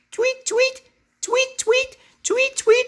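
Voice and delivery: very high loud chirps; high bird tweets. Pretend.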